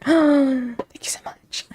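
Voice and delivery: breathless whispered thanks after a gasp; hushed slow thank you. Real, not acted. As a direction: whisper it slowly, overwhelmed. Gasps then whispers thanks.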